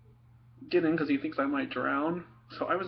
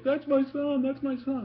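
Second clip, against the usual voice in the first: proud crying dad; 12 semitones higher than usual. Retold or imitated. Proud dad crying in the stands at Little League, higher choked cheer. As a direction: cheer through happy tears. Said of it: "so happy and proud that he was crying".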